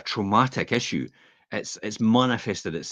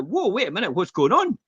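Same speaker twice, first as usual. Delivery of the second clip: mock startled boss; 12 semitones higher than usual. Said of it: "And it's like".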